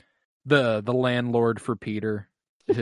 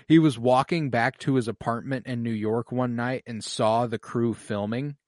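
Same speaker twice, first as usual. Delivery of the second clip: ordinary talk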